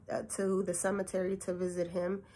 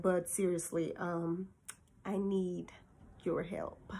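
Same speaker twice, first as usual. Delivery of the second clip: hushed plea; 2 semitones lower, 8 dB quieter than usual. Retold or imitated. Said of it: "And then I'm like".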